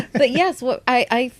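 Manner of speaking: normal talking voice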